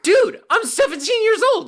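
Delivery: very high, loud disbelief; shrieking protest. Retold or imitated. Teen protesting to his coworker, high loud squeal. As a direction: squeal it in horror.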